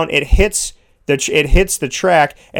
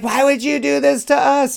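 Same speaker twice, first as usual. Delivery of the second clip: mock panic; 10 semitones higher than usual. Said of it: "she's just like".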